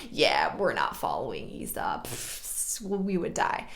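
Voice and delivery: very low, dropped voice; mock deadpan aside. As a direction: drop right down for a private refusal. Jacob privately drops the friendly act.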